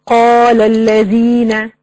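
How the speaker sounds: slow recited قال الذين with even long vowels; measured tajweed reading of قال الذين; natural madd recitation. Shown as a demonstration. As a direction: chant قال الذين slowly on a level note. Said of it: "إذا وقفت على المد الطبيعي زي كلمة".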